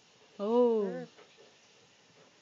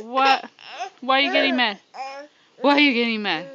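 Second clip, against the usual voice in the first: louder, mock-startled questions; mock surprised; 8 dB louder than usual. Real, not acted. Baby gets upset, parent responds.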